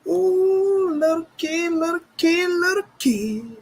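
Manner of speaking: high, teasing sing-song; high mocking voice; high mocking taunt. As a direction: squeak it up to mock him.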